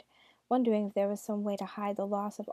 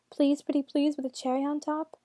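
mock pleading; 5 semitones higher, 4 dB louder than usual. Narrator mocks a sinner begging.

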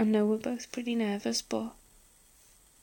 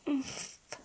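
usual speaking voice

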